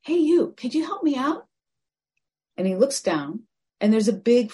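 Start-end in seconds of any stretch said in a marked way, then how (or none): 0.0-1.5 higher, louder plea for help; frog voice calling. Acted. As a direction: get his attention from below. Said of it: "again, he hears".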